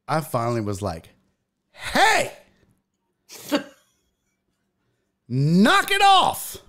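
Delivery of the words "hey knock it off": put-on high, loud shout at a kid; sudden shouted scolding; retold yell. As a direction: bark it across the waiting room. Yelling at kid in ICU.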